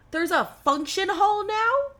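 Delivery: high, loud startled question; suddenly noticing something unexpected; surprised. Real, not acted. Gamer spotting a new room in his dorm, surprised.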